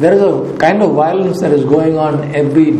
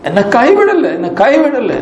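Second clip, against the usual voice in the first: desperate pleading; 10 semitones higher, much faster than usual. Retold or imitated. Distraught woman on the phone pleading with the preacher, desperate high cry. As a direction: beg him not to abandon her, voice pitched up. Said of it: "That's what she's saying over the phone".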